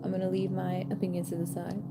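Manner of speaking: usual speaking voice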